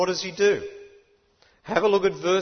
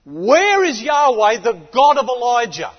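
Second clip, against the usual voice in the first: a prophet shouting across the Jordan; yelled out; 4 semitones higher, 8 dB louder than usual. Retold or imitated. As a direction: call it across the river. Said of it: "he yells it out back to them".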